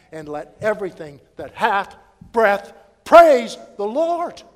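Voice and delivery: loud, ringing pulpit voice; full-force preaching. Acted. Preacher thundering scripture to the church, loud and ringing. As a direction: preach it out big to the congregation.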